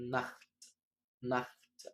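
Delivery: نخت said as German Nacht, with a throaty kh; teacher modelling نخت as Nacht; German pronunciation. Shown as a demonstration. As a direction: read نخت slowly with a rasping kh. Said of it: "نقرأ أول كلمة".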